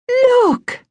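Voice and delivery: high, bright call of delight; excited cry. Acted. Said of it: "cried Penelope".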